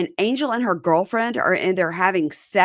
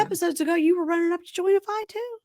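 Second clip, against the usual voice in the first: much higher mocking voice; sarcastic call-out; 13 semitones higher than usual. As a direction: call her out in a high squeal. Mocking Stephanie again.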